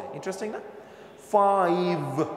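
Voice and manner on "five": "ফাইভ" drawn out, distinct f and buzzing v; slow model "ফাইভ" with lips never meeting; clear f and v. Shown as a demonstration. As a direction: stretch "ফাইভ", teeth on lip at both ends. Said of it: "ফাইভের মধ্যে খেয়াল করেন".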